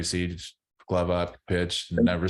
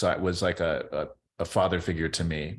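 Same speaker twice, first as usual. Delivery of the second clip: normal talking voice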